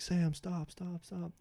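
high-pitched mock yell, held back in volume; retold yelling. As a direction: imitate kids calling out, without real volume. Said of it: "noticing that we're yelling".

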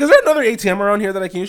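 high, fast, eager question; hurried ask. Retold or imitated. He asks the clerk for another ATM, high hurried plea. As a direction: rush it out, exasperated.

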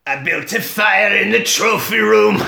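high, strained put-on old Scot voice; [name]. Jack proudly announces the trophy room, hammy acting. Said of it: "[name]'s acting face".

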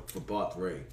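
ordinary talk

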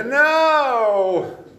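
put-on bratty teenager shouting no; whiny kid refusing. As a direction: play a teen flatly refusing the plan. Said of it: "everybody's gonna say".

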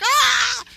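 high yelped Ah of fright; a hammed-up shriek of fear; mock terrified scream. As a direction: yelp like you just saw an angel. Mock scream at angel visit.

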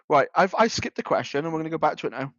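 ordinary talk, nothing marked

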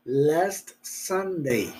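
very slow, soft LAST SUNDAY with the T sounded; slow careful demo. Shown as a demonstration. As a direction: drag out last Sunday so the T is heard. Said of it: "hay que tener cuidado que suene esa T".

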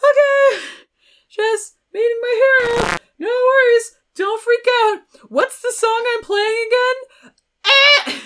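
very high, loud mock panic; frantic self-reassurance, comically overblown; freaking out. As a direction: squeal through a hammed-up panic. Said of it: "a tiny bit freaking out".

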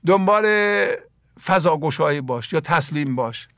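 ordinary talk

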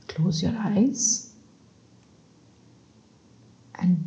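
slow, hushed sleep-meditation voice with long pauses; guided meditation. Acted. Guide leads listeners through breathing before sleep, slow soothing meditation. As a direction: lull them gently toward sleep, breath by breath.

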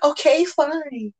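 high, slow, prissy "Okay, fine.". Retold or imitated.

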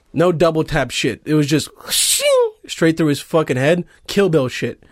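usual speaking voice

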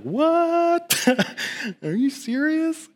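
sudden high squeak of surprise; What? shot up high, then a laugh and disbelief; stunned disbelief. Retold or imitated. Preacher retelling the cashier waiving his bill, stunned. Free food at Taco Bell.

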